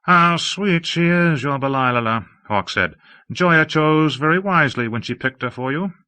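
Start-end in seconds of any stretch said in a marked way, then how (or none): 0.0-2.2 higher, slowed, fond admiration; a drawn-out, cooing high voice; Hawke, slow and sugary. Acted. 3.3-5.9 higher, affected aesthete voice; a lofty, admiring high voice; Hawke, airy and effusive. Acted.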